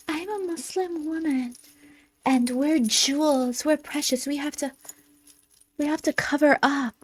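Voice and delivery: high soft mocking voice; mocking imitation. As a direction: mimic her in a breathy sing-song. Mocking her political agenda.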